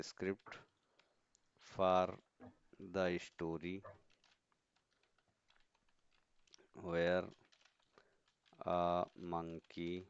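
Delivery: word by word with long gaps; slow dictation. Real, not acted. Teacher typing a prompt for the class, slow dictation. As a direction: spell out a prompt slowly as you type.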